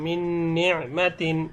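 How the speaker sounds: "মিন নি'মাতিন" slow, with the joined n held; ghunnah recitation. Shown as a demonstration. Teacher giving only the pronunciation, slow nasal hum. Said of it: "শুধু উচ্চারণটা আমি বলছি".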